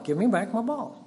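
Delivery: put-on a touch of a boy's demand; coached demand. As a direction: say it like dad coaches. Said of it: "You tell him".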